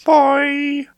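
very high, loud cartoon squeal; squeaky creature call.

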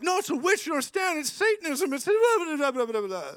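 high, loud mock hysteria dissolving into babble; worked-up mob. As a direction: imitate a frantic group all worked up. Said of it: "all these people get all worked up".

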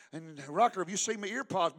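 ordinary delivery

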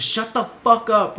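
loud, high, drawn-out furious yell; retold scream. Son screaming at his panicking father, furious yell. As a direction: scream it to silence him. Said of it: "I screamed. I screamed at him".

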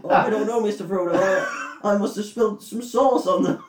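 high, timid hobbit voice; squeaky, sheepish Sam impression. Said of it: "That's what he said".

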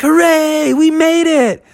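very high joyful cheer. Acted. Friends cheer outside the cave, very high and joyful. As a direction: celebrate escaping the cave. Celebrating escape.

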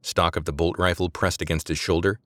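usual speaking voice

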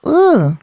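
high, recoiling groan; playful high "ugh" of annoyance; mock disgusted ugh. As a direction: groan as if it's too much. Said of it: "it just gives you, like, this".

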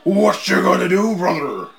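a lightly voiced Hogan catchphrase; Hulk Hogan impression. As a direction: growl it like a hint of Hulk Hogan. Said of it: "it's Hogan guy".

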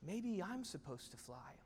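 soft, tentative inner thought; wondering thought. Acted. Young eagle thinking to himself after seeing a bird, quietly wondering. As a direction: quietly wonder it, almost to yourself. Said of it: "and he thinks".

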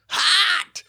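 slow, strained squeaking cry; squeaky screech. Shown as a demonstration. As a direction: squeeze out a high screech. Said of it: "I love those squeaks".

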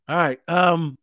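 usual speaking voice

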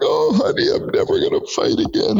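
high, whimpering mock sob, mostly breathless; imitating his crying. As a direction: replays his own tearful wail in a high squeak. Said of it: "I was crying in the car".

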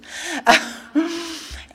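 high breathy laugh. Real, not acted. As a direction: laugh at herself. Laughing at own defiance.

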